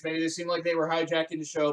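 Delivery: ordinary talk, nothing marked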